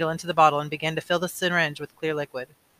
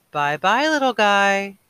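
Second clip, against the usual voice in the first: put-on baby voice; 4 semitones higher, 7 dB louder, much slower than usual. Father's baby voice.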